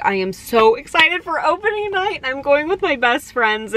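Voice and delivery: bubbling over with anticipation; giddy excitement. Retold or imitated. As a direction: gush about the big night. Giddy excitement about opening night.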